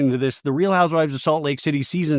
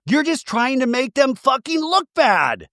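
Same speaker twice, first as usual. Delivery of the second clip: mock scream; 10 semitones higher than usual. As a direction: mimic a furious outburst. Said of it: "Dodie screams out".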